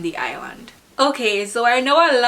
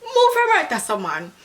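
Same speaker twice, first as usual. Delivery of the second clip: very high, loud dismissive patois; annoyed shoo; 8 semitones higher, 3 dB louder than usual. Shown as a demonstration. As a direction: send the pest away sharply. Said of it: "someone is just annoying you".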